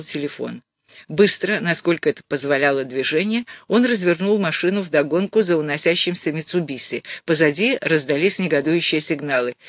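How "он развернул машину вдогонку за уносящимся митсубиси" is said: ordinary delivery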